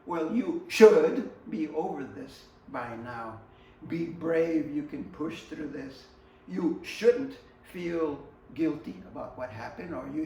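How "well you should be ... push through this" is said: mock loud scolding. Mocking the impatient friend's advice.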